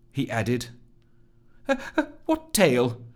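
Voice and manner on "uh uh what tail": high, flustered burble; embarrassed high-pitched stammer; burbling. Acted. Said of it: "burbled Rusty".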